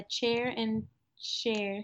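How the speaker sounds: "chair and share" slowly, ch then sh; distinct CH and SH. Shown as a demonstration. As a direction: separate "chair" from "share", drawn out. Friend demonstrates the CH-SH difference.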